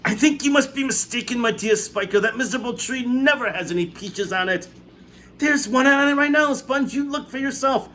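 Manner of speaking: two aunts arguing; bickering aunts. A narrator's reading. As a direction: sisters quarrelling over a peach. Aunts bicker, high voices.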